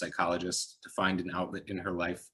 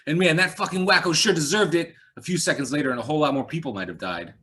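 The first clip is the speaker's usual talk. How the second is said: loud, higher gushing cop voice; excited; 8 semitones higher, 9 dB louder than usual. A narrator's reading. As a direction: keep raving about the shooting.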